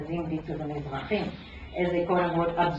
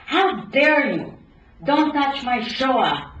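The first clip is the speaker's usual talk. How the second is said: slow, higher, louder outrage; indignant exclaim; 5 semitones higher, 6 dB louder, slower than usual. A narrator's reading. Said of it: "exclaimed Ludmila".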